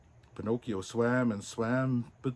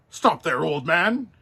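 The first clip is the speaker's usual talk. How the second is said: policeman; 5 semitones higher, 7 dB louder than usual. Acted. Policeman orders Geppetto.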